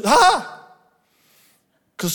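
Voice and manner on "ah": high, loud startled "ah"; loud cry of dismay. Acted. Preacher quoting Jeremiah's first word to God, startled cry. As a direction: yelp it out in alarm. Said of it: "He said these brilliant words".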